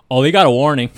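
loud, hammed-up proclamation; loud mock announcement. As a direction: blurt it out like big news. Mocking John's announcement.

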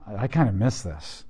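soft, low, wistful little confession; a quiet regretful sigh of a line; wistful admission. Retold or imitated. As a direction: admit it quietly, almost to yourself. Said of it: "you're like".

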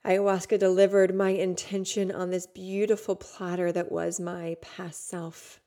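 normal talking voice

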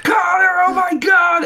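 high-pitched imitation of a frantic yell; mock yell. Retelling a friend yelling his name at a concert, high mock yell. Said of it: "I remember you yelling my name".

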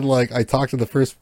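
usual speaking voice

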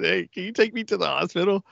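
high, anxious plea to take him to hospital; scared plea. Retold or imitated. To girlfriend, a high, panicky plea for the hospital. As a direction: ask for help like you think you're dying. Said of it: "I said".

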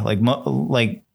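normal talking voice

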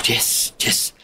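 hushed whispered yes. Retold or imitated. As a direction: whisper it so no one hears.

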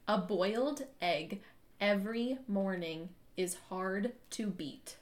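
slow exaggerated mouthing for lip reading; over enunciating. Shown as a demonstration. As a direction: stretch every word out slowly for her to read. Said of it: "hear you whispering a little bit".

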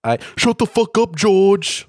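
high, loud mock outburst; George impression bit. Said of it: "I'm gonna do my George impression".

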